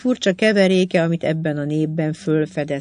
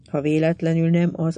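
usual speaking voice

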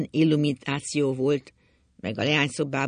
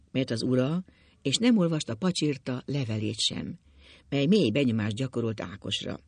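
ordinary delivery, nothing marked

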